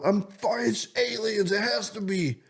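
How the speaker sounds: high, certain mock panic; hammed-up alarm, sure it's aliens; panicked. Telling his partner the lights must be aliens, in high mock panic. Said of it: "straight panic mode".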